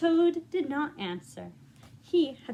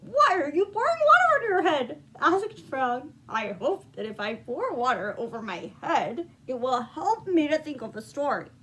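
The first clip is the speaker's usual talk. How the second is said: livelier, higher read-aloud as the story builds; rising storybook narration; 3 semitones higher, 5 dB louder than usual. A narrator's reading. Reading the climax to young listeners, rising excitement. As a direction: let the narration climb with the fun.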